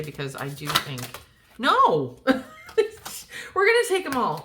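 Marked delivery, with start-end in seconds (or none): 1.6-2.2 surprised cry. Real, not acted. Cards suddenly spill out. 2.3-4.4 laughing it out, high and loud. Real, not acted. Reader laughing to her viewers as the whole deck spills.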